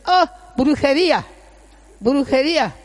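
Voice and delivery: retold cry of alarm, high and loud; alarmed exclamation. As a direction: call it out like a startled doctor's discovery. Doctor's alarmed cry, acted out.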